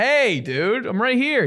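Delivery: high-pitched pestering dog voice; annoying attention-seeker. Retold or imitated. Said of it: "like, annoying".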